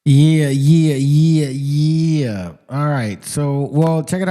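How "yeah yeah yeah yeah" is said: hyped yeah. Real, not acted. Host hyped after clip.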